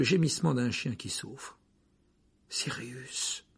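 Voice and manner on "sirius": much softer, breathy whisper into the dark. Acted. Harry peers into the dark, whispering the name. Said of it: "murmura Harry".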